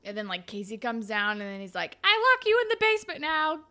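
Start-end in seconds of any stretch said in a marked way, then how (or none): 2.0-3.6 high, loud put-on order; mock command. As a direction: imitate him ordering them about, pitched way up. Retold host's mock command.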